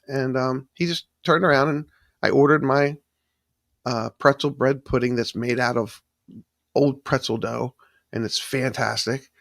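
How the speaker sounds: ordinary delivery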